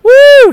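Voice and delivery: a big high whoop of joy; excited whoop. Retold or imitated. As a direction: whoop loudly like a thrilled servant. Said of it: "He's like".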